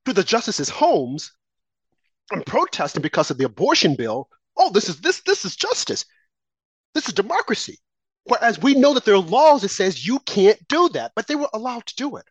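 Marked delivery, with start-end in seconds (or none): 4.6-7.8 high, sneering parody of a smug excuse; mock them with a high sing-song; mocking mimicry, well above his own pitch; sarcastic mock voice.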